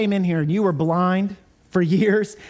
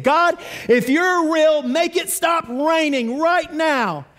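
put-on higher, slow, earnest child's plea to the sky; bold childlike prayer; 5 semitones higher, slower than usual. Little Baptist boy holding up his Bible, begging God, high and slow.

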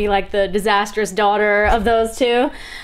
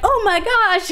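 surprised fan; 11 semitones higher, slower than usual. Retold or imitated. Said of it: "And they're like".

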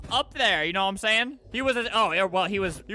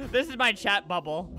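ordinary talk

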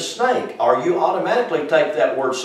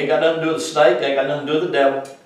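rapid dismissal; much faster than usual. Acted.

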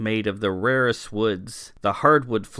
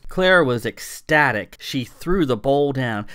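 ordinary delivery, nothing marked

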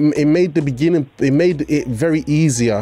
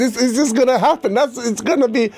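excited; 7 semitones higher than usual. Real, not acted. Excited laughing about EastEnders drama.

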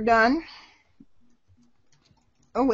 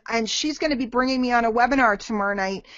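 usual speaking voice

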